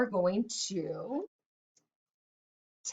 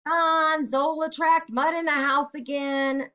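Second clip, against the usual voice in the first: high, louder call across the house; 7 semitones higher, 4 dB louder, slower than usual. Shown as a demonstration. Teacher calling to Don about the muddy dog, raised call. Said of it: "I could do a dialogue where I'm talking".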